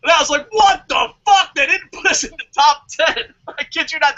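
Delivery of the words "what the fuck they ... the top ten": loud, high retold outrage; outraged response. Podcaster re-enacting his response to the top ten list, outraged. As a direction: shout it in mock disbelief. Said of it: "that was my response".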